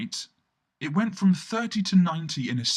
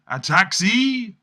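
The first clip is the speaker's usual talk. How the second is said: slow, loud, high call out; shouting; 6 semitones higher, 8 dB louder, slower than usual. Retold or imitated. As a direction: hail a taxi across the street. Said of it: "some shouting".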